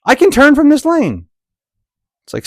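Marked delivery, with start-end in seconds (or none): 0.0-1.3 voicing the frustrated driver. He's like.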